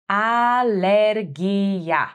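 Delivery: Alergia stretched out slowly, each syllable clear; slow for repeating. Shown as a demonstration. Teacher to learners, slowly modelling Alergia. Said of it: "plain take before slow repeat".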